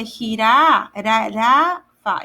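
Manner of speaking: one light tap on the R in "jira-, ra-, ra-fa"; unexaggerated R. Shown as a demonstration. Teacher showing the single R, light tap in "jira-, ra-, ra-fa". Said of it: "So I'm not exaggerating it".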